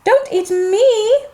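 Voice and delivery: high, slow pleading goat; crying middle goat. Acted. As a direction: beg not to be eaten. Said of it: "cried the middle-sized goat".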